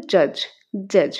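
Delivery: জাজ, slowly modelled as English judge; demo of judge. Shown as a demonstration. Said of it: "এটা একটা কী হবে?".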